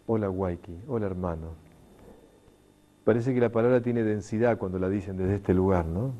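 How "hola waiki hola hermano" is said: softer and lower, heartfelt; tender greeting. Retold or imitated. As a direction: greet a dear brother softly. Tender Andean greeting retold.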